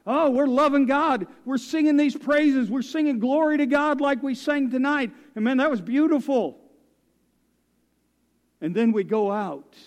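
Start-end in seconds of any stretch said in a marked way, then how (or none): 0.1-6.6 high, loud worship fervour; acted gushing enthusiasm. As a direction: gush like an excited crowd praising. Voicing the enthusiastic praising crowd.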